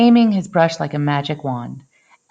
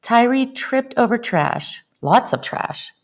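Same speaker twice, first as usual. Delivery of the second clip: usual speaking voice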